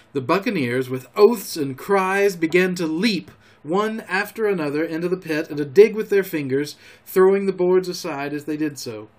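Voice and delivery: ordinary delivery, nothing marked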